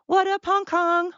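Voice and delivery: high-pitched playful greeting; excited shout-out. Real, not acted. Host greeting listeners in Hong Kong, excited and high. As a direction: pitch it way up for a fond hello.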